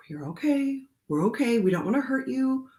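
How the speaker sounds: calm a scared bird tenderly; tender retold comforting; soothing reassurance.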